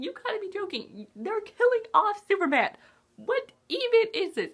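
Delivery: indignant high-pitched you gotta be joking; outraged disbelief. Retold or imitated. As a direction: cry out as if betrayed by the film. Disbelief at Superman's death.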